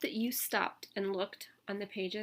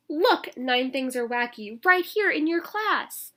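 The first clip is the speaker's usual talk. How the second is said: louder and slowed, urgent storybook yell; 5 semitones higher, 11 dB louder, slower than usual. Acted. Boy yells to teacher Miss Bass, slow and louder. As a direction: insist the teacher look, drawn out. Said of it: "I yelled to Miss Bass".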